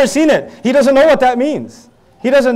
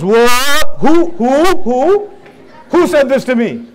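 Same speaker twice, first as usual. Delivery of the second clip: indignant surprise; much slower than usual. Retold or imitated. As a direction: bristle at the insult like a tribe leader. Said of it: "So he goes".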